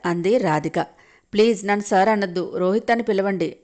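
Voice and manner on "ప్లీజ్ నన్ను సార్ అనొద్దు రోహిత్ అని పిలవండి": ordinary delivery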